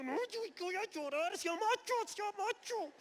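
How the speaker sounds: choked-up high voice telling himself not to cry; holding back tears. Retold or imitated. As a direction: fight off tears through clenched teeth, slowing at the end. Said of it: "yo apretaba los dientes diciendo".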